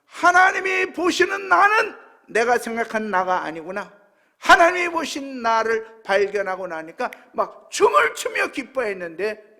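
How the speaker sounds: full-force preaching. Real, not acted. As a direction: cry out the testimony to the congregation. (목소리 높여 외치는 설교)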